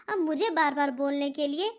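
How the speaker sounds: ordinary talk, nothing marked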